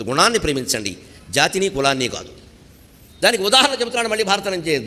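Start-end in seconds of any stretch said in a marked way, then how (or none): none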